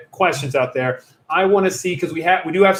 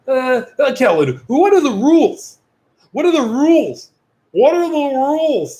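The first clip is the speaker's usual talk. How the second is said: high, put-on questioner voice; high, hammed-up whining question; silly mocking voice; 8 semitones higher, 4 dB louder than usual. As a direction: imitate a pestering viewer, pitched way up. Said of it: "Don't talk like that".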